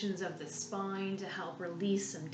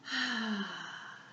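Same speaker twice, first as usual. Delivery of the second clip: long, falling exhale; settling sigh; 2 semitones higher than usual. Shown as a demonstration. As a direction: let the breath go in an audible sigh. Settling in before relaxation.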